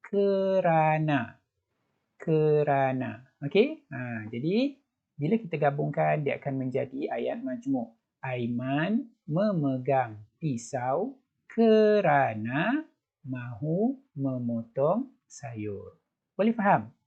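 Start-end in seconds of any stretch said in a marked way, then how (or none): 0.0-3.2 kerana drawn out slowly, syllable by syllable; teacher modelling kerana; slow drilled word. Shown as a demonstration. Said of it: "dengan kata hubung". 8.2-16.0 slow teacher read-aloud; slow model reading. Shown as a demonstration. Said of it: "cikgu ulang sekali lagi".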